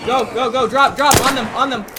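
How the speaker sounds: high, loud urgent yelling; excited callouts. Real, not acted. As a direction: bark the orders fast and hot. Frantic fight callouts.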